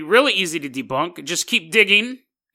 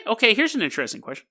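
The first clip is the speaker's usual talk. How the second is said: ordinary talk, nothing marked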